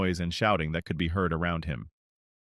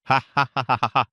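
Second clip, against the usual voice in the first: booming ha ha ha laugh, louder; loud laughter; 4 semitones higher, 7 dB louder than usual. A narrator's reading. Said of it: "laughed out loud".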